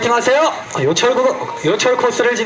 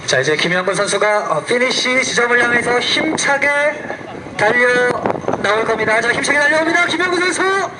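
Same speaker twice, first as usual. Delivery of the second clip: building play-by-play call, rising higher; motorsport commentator; 4 semitones higher than usual. Acted. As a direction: call the finish with rising excitement. Live play-by-play of the finish.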